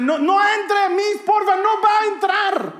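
high, loud mock-desperate yell; panicked shouting. Boy yelling to his teacher outside the bathroom, panicked shouting. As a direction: shriek it as a mortified child, dragging out the end. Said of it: "empecé a gritarle".

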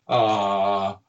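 long, drawn-out "ah"; demonstrated "ah". Shown as a demonstration. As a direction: show the group the groan to make. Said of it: "everybody at the same time to go".

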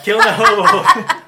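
very high, loud giggling; laughing squeal. Pretend. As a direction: squeal it through laughter, slowly. Joking echo, laughing high.

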